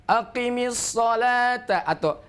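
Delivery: slow chanted recitation, breath held. Shown as a demonstration. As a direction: recite slowly, keeping air in. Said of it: "Benar-benar dijaga nafasnya".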